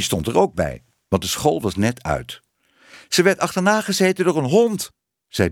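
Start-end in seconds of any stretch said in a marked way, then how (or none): 3.1-4.9 high, lightly voiced child; kind dat nieuws meldt. A narrator's reading. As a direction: blurt the news like a schoolchild. Schoolmeisje meldt wat er gebeurde. (hoog kinderstemmetje)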